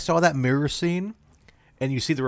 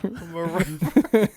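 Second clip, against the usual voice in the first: mock rapper; 2 semitones higher, slower than usual. Echoing as Connery.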